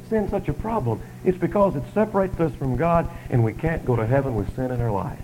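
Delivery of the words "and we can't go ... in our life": normal talking voice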